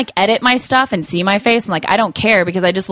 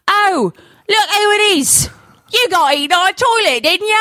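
park character voice; 11 semitones higher than usual. Acted. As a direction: greet the star in a big show voice, pitched way up. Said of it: "in character".

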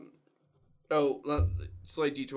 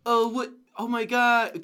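higher, louder mock surprise; startled quote; 7 semitones higher, 7 dB louder, slower than usual. Friend back from the bathroom, mock surprised. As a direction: imitate a friend caught off guard, voice up.

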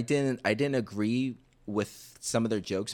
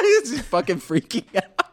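laughing; 14 semitones higher, 9 dB louder than usual. Real, not acted. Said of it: "laughing at the scene".

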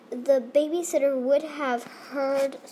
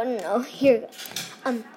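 usual speaking voice

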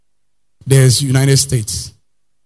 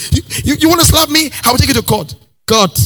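shrill outraged wife; 11 semitones higher than usual. Acted. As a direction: spit out the threat of court like an outraged wife. Wife threatening husband.

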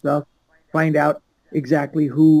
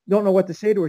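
ordinary talk, nothing marked

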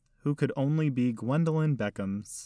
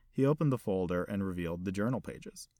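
plain reading voice

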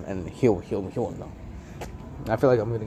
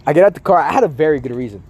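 normal talking voice

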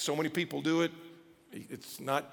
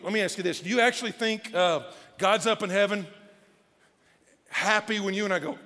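ordinary delivery

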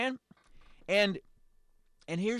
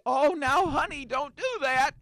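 high, louder mock-soothing wife; 9 semitones higher, 4 dB louder than usual. Host imitates a wife calming her husband, high mock-soothing. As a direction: coax him like a sweet wife calming her man. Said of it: "And then women would say".

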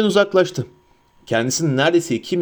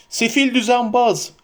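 high, drawn-out curse; raging cry; 9 semitones higher than usual. A narrator's reading. Boromir screaming at the vanished Frodo, raging. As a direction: hurl the insult after him. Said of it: "diye haykırıyordu".

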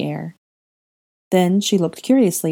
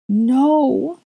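higher, louder sobbed cry; wife hearing of the dead, higher, louder sob; sobbing no; 9 semitones higher, 4 dB louder than usual. A narrator's reading. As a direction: break into a sobbing protest. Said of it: "Hermione sobbed".

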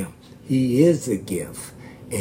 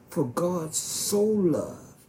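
slow and higher, "so" drawn out in "For God so loved."; stress on so; 6 semitones higher, slower than usual. Shown as a demonstration. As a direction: preach "so" with weight. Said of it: "It's the emphasize".

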